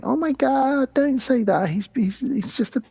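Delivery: high, fussing motherly voice; mock Tiffany. Mother doll defending her son, high and fussing. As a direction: scold him gently in a high voice. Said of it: "Tiffany's like".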